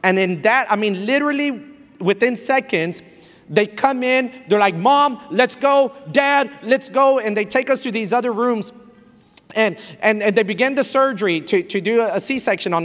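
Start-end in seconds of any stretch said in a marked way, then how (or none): 4.7-7.2 loud, high, drawn-out hospital call; urgent call. Retold or imitated. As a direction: call the parents urgently, slowly. Staff calling parents to surgery.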